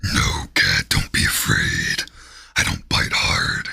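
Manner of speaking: very low, growling demon voice; deep, rumbling demon growl. Pretend. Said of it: "Five minutes of my demon voice".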